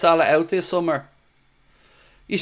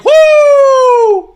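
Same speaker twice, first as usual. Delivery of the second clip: much higher, louder wrestler woo; Ric Flair woo; 11 dB louder than usual. Retold or imitated. Fan showing his dog doing Ric Flair, high loud woo. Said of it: "full Ric Flair".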